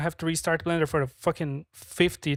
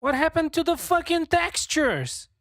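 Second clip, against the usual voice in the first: much higher, loud outraged shout at the screen; shocked yell; 13 semitones higher, 5 dB louder than usual. Real, not acted. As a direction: yell at the broken textures in disbelief. Textures suddenly broken.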